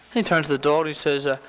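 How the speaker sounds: usual speaking voice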